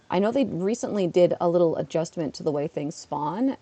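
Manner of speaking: ordinary talk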